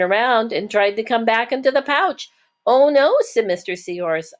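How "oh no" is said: higher and louder gentle oh no; a fond startled oh no; surprised. Acted. Mister Seahorse seeing a baby swim back, surprised.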